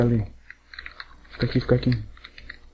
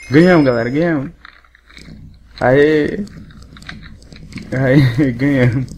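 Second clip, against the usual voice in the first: grito de vitória, alto e alegre; comemorando alto; 6 dB louder, slower than usual. Real, not acted. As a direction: celebra a vitória com a galera. (gritando de alegria pela vitória)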